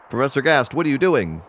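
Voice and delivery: high-pitched startled demand; alarmed protest. Acted. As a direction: call out in acted alarm. Villain reacts as father resists.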